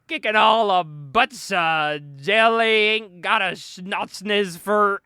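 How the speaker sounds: put-on slow, slurred drunken babble; sloppy drunk, words running to mush; drunk dude. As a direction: slur it into nonsense, dragging every word. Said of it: "we see this drunk dude".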